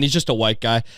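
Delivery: normal talking voice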